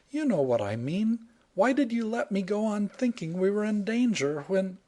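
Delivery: high, reproachful woman's voice; indignant heroine. Acted. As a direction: scold him for the trick.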